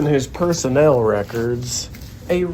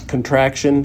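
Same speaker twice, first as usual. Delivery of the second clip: usual speaking voice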